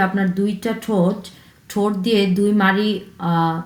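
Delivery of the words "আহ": ordinary talk, nothing marked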